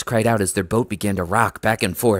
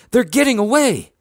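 very high, loud alarm; high-pitched excited yell; alarmed cry; 6 dB louder than usual. A narrator's reading. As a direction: cry out an urgent alert. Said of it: "I cried".

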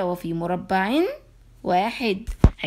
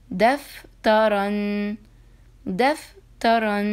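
دفترا joined, slow and drawn; slow blended word; 4 dB louder, much slower than usual. Shown as a demonstration. Teacher to class, slowly blending دفترا. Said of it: "هيا نقرأ الكلمة التي تليها".

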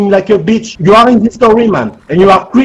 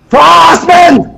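angry shout; 6 semitones higher than usual. Real, not acted. Angry player yelling at roleplayers.

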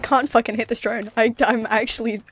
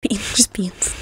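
whispering; 4 dB quieter, slower than usual. Acted. Streamer in whisper challenge.

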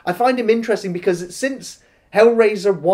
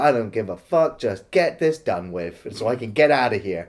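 bored cop; 8 semitones lower than usual. Retold or imitated. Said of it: "this cop who's just like".

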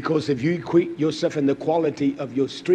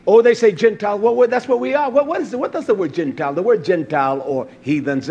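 loud, driving sermon delivery; fiery pulpit preaching; full-force preaching; 4 semitones higher, 5 dB louder than usual. Acted. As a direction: preach it out to the congregation. Preaching on word Gentile.

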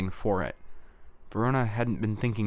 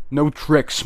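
raised, forceful warning shout; 6 semitones higher, 8 dB louder than usual. A narrator's reading. Musser warning off the others, shouting. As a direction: shout it as a loud, higher-pitched order. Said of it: "Musser shouted".

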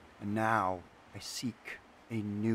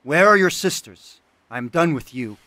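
loud, high shouted questions; angry demand; 6 semitones higher, 19 dB louder than usual. Acted. Artisan yelling across the pond for the sisters, loud. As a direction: bark for the sisters to show. Artisan yells after the spirit.

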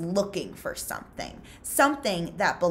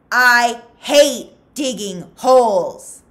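shout; 7 semitones higher, 15 dB louder, slower than usual. Acted. Zero after hitting Pendanski.

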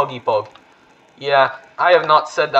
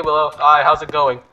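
usual speaking voice

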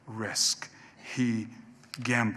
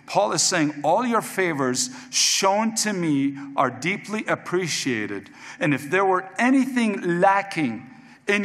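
raised, forceful pulpit voice; impassioned preaching; 3 semitones higher, 6 dB louder than usual. Real, not acted. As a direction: preach it hard, higher and louder.

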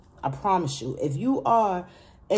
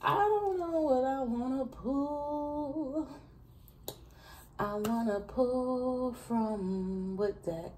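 high musing voice; 9 semitones higher, 5 dB quieter, much slower than usual. Acted. Musing aloud over tarot decks.

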